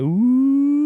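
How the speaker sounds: high, eerie ghost moan. Retold or imitated. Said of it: "they make you sound like a ghost".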